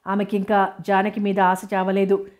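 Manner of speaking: usual speaking voice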